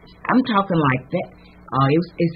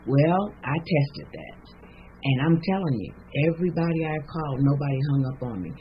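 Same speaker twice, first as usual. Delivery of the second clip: ordinary delivery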